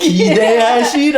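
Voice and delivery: high, loud, quick with a laugh; laughing playful promise; teasing hype. Shown as a demonstration. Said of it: "I'm like".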